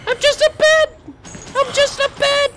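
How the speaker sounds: very high, loud silly squeak; squeaky falsetto. Pretend. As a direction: squeal it like a cartoon. Pretends to be a bed.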